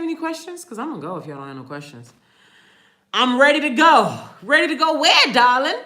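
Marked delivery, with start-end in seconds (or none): 3.1-5.8 loud and higher, hammed-up; mock-dramatic call to a darling; playful loud outburst. Sudden playful outburst while waiting for questions.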